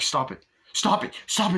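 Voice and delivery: acted urgency, building; mock panicked demand. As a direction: imitate a passenger panicking in a taxi. David demands the taxi stop.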